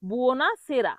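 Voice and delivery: বুওনাসেরা as "buo-na-se-ra"; careful model বুওনাসেরা, "e" after s; correct pronunciation. Shown as a demonstration. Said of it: "সঠিক উচ্চারণ কি হবে?".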